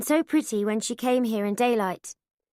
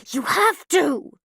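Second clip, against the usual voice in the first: hissing; 3 semitones higher, 5 dB louder, slower than usual. A narrator's reading. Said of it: "she hisses".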